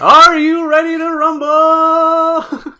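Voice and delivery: high, loud, stretched ring-announcer call. Pretend. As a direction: boom it like a boxing announcer. Boxing announcer parody.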